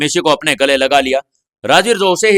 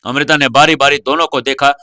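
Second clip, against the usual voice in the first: ordinary delivery, nothing marked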